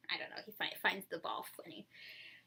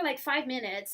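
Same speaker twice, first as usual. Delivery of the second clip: ordinary talk, nothing marked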